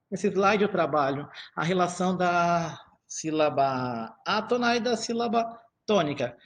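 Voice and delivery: usual speaking voice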